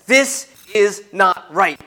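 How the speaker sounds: slow, emphatic rebuke, word by word. Acted. Jesus rebukes the demon oppressing a man, slow stern rebuke. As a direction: rebuke it with deliberate weight on each word. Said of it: "He rebukes him".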